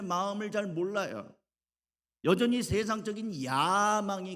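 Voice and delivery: high, loud, driving sermon delivery; impassioned preaching. Real, not acted. Preacher exposes disciples' worldly ambition, impassioned high preaching.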